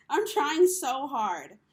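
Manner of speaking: "I'm trying so hard" pushed out loud and slow; a real, slowed, emphatic plea. Real, not acted. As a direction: plead it, each word weighted. Repeats the plea, pleading.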